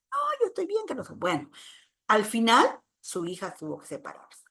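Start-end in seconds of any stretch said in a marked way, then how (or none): 0.1-1.1 a much higher, dismissive voice for the sister; imitating sister's brush-off. Lecturer quoting her sister refusing therapy, in a high dismissive voice. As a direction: toss it off lightly, the way she refused help.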